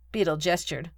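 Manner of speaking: ordinary delivery, nothing marked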